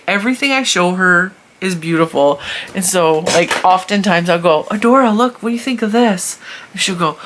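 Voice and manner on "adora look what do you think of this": higher, raised auntie voice; talking to a child. Retold or imitated. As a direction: eagerly show a little girl something. Asking niece to look.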